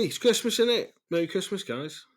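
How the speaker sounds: high, put-on Christmas cheer; sudden festive lift after a flat mood; forced cheer. Real, not acted. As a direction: perk yourself up for Christmas. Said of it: "but I should be".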